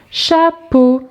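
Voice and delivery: high, segmented teacher's demonstration; syllable by syllable. Shown as a demonstration. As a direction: call out "Chapeau" in two beats. Exercise word for young readers.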